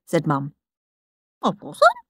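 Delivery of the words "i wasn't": Henry's denial. Acted. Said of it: "said Henry".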